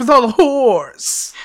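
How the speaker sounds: slow, loud mock ad read, drawn out; imitating the ad voice. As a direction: savor it like the radio ad.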